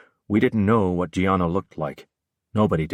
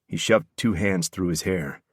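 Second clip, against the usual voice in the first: plain reading voice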